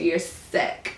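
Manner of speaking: hushed, breathy mimicked put-down; mock his pet phrase under your breath; mocking imitation. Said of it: "who the fuck says stuff like that".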